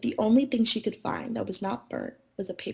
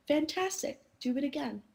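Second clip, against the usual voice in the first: mild narrated shout; 5 semitones higher than usual. A narrator's reading. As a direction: read it as a light storybook shout. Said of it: "Elizabeth shouted".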